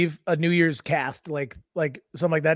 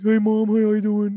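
slow, higher put-on pet voice; talking dog voice; 4 semitones higher than usual. Dog answering its mom, slow put-on pet voice. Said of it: "Do you ever respond as Deeks?".

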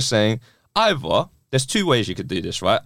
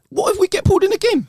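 very high, alarmed question; incredulous. Real, not acted. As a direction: shoot the pitch up in alarm. Incredulous outburst.

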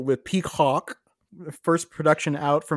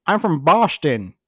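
put-on Boston accent; 5 dB louder than usual. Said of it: "practicing my ventriloquism so that I can properly do a Ted voice".

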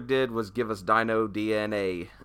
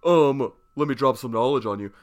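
mock condescending lecture; imitating a know-it-all dropping knowledge; smug know-it-all; 2 semitones higher, 4 dB louder than usual. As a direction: play the smug expert, lightly. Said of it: "I don't wanna sound like an asshole".

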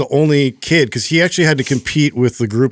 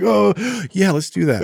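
put-on higher, perked-up boy; eager kid voice; 8 semitones higher than usual. Son brightening at offer of ice cream, eager kid. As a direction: play the kid cheering up at the offer. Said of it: "he's just like".

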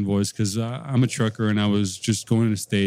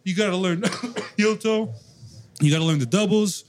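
higher, sing-song mock lecture voice; mocking know-it-all teacher; 7 semitones higher than usual. Drummer mocking the advice of drum teachers, higher sing-song voice. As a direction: parrot the drum gurus' endless rules. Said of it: "But they say".

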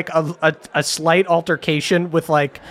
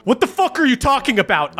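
quick, high-pitched mock indignation; emotional outburst; 7 semitones higher, faster than usual. Young lord confronting a reporter, quick and indignant. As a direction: fire it off, lightly acted offence. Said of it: "starts to get emotional".

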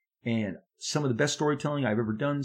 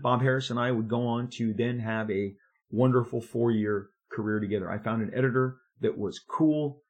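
normal talking voice

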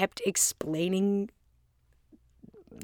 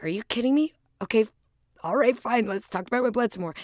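higher, louder mock disbelief then quick grudging give-in; exasperated protest; 5 semitones higher, 5 dB louder, faster than usual. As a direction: retell it like you can't believe she asked again, then rush through giving in. Retold exasperation at her coach.

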